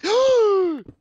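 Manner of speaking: loud high yelp of pain; sharp gasp. Real, not acted. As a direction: cry out as you get hit. Hit by a player.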